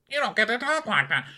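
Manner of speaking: very high falsetto mock. Host parodying the catchphrase in a squeaky high voice. Mocking recurring line.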